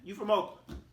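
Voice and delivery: high, loud hometown reproach; indignant scolding. Retold or imitated. Scolding the player in retold reaction.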